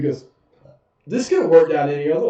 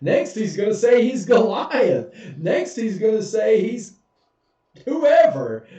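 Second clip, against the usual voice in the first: higher, sarcastic escalation; scornful king, much higher; mocking king; 6 semitones higher than usual. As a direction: pile on the ridicule, rising to whoever.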